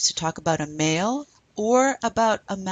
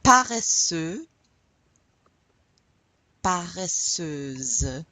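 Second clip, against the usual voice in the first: slow model; much slower than usual. Shown as a demonstration. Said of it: "Say that again with me".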